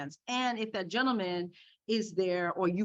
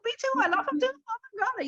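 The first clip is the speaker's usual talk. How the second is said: much higher, fast squealing me too; excited fan gush; faster than usual. Retold or imitated. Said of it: "I wanted to say".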